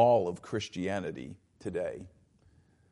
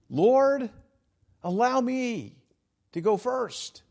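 raised, eager voice of a would-be disciple; eager follower; 11 semitones higher, 4 dB louder, slower than usual. Acted. Would-be disciple asks the Lord for leave to go first, eagerly. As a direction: play an eager volunteer, pitched well up. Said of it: "the enthusiasm and the eagerness".

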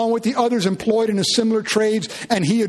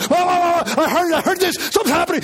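higher, breathless high school rumor; excited student; 7 semitones higher than usual. Retold or imitated. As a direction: hype it up like a kid rushing to a fight. Excited high school kids rushing out.

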